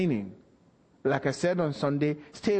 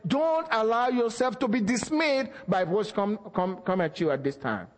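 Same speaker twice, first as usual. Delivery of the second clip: raised, impassioned sermon voice; fervent preaching; 6 semitones higher than usual. Acted. Pastor exhorting the church with fervent preaching. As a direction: preach it with rising fire to the congregation.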